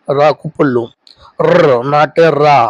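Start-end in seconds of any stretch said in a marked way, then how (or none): 1.5-2.6 र said slowly and held, a clipped consonant; र, a bare consonant said slowly; drawn-out demo sound. Shown as a demonstration. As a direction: slowly sound out र with no vowel after. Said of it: "इसको रा बोलते हैं".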